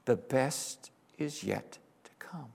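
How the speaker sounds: slow hushed whisper. Real, not acted. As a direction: breathe it as a promise. Sermon close, whispered.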